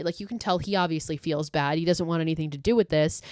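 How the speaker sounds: normal talking voice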